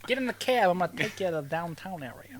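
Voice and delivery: put-on Brooklyn accent. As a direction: play a Brooklyn cabbie. Brooklyn cabbie bit.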